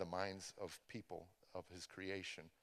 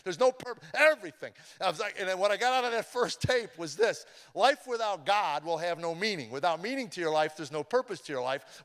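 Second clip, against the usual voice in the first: loud, high, driving preacher voice; preaching at full force; 9 semitones higher, 12 dB louder than usual. Real, not acted. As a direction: preach it out to the whole church, louder and louder. Testimony builds into full preaching.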